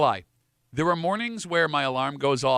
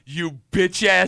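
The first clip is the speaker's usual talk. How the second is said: mock insult; 3 semitones higher, 6 dB louder, much slower than usual.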